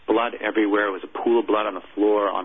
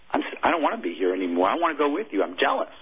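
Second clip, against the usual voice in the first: choked up; 5 semitones higher than usual. Real, not acted. Chaplain recalling his words to a dying soldier, choked up. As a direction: voice climbing high as emotion takes over.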